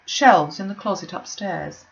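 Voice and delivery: ordinary delivery, nothing marked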